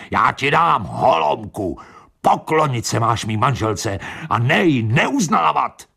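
high, indignant acted holler; bellowed threat. A narrator's reading. Pitra yelling from the doorway into the night, threatening. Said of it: "řval do tmavé noci".

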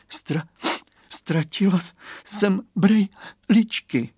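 put-on slow, sobbing little girl. A narrator's reading. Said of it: "zavzlikala Kateřinka".

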